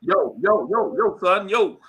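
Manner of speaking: put-on a light New York street accent, a touch upset. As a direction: toss out a mild New York "yo, son" as if getting riled. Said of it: "Let me get upset. You'll hear it".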